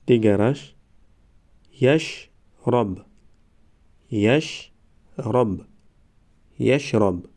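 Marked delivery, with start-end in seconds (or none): 1.8-5.7 slow, syllables held and separated; slow syllable reading. Shown as a demonstration. Teacher models first readings for learners, slow syllable by syllable. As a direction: sound it out for learners, pausing between syllables. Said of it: "بار یکم و دویم آهسته".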